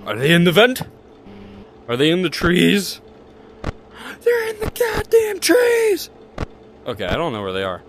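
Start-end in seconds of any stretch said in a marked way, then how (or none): 4.2-6.1 much higher, hammed-up panic; mock frantic yell; high mock shriek. Mock panic about enemies.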